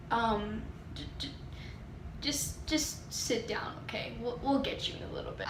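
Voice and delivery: hushed, halting stammer; imitated shocked receptionist, near whisper; stunned office lady. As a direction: quietly stumble over it, taken aback. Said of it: "like, these wide eyes".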